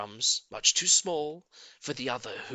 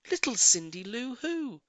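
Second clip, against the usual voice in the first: ordinary delivery, nothing marked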